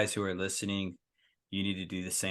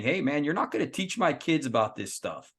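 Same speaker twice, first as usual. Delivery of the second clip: higher, louder mock confrontation; lightly voiced protective father; 7 semitones higher, 5 dB louder than usual. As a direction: lightly act out a big dad standing his ground. Imagined lineman confronting school agenda pushers.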